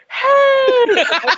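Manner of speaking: high, whooping party greeting; high-pitched retold holler; imitated yell. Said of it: "yelling at the".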